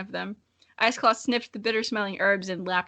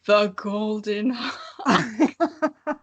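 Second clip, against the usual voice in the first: laughing; much slower than usual. Real, not acted. Laughing at a name.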